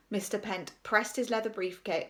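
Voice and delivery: plain reading voice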